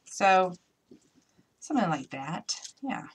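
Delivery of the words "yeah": ordinary talk, nothing marked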